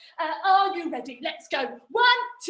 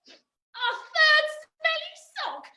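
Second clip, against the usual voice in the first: mock dismay; 10 semitones higher than usual. Trick fails again, mock dismay.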